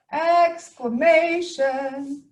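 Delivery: very slow, stretched-out showy word; drawn-out, hammed-up announcement; playful exclaiming. Shown as a demonstration. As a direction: stretch every syllable with playful excitement. Playfully demonstrating exclamations as exciting.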